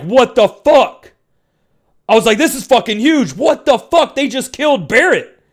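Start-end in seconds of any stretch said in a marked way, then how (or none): 0.0-1.1 high, drawn-out disbelief; retold shock, stretched out slowly; freaking out. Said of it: "And I'm freaking out". 2.4-5.4 high-pitched retold shock; freaking out. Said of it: "And I'm freaking out".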